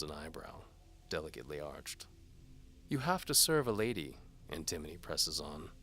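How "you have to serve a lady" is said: higher, louder teasing lady voice; seductive noblewoman. A narrator's reading. Antimony dares the knight to follow. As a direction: tease the knight like a bold noblewoman.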